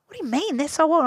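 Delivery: high, puzzled question; lightly voiced baffled manager; surprised. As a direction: sound amazed he has them.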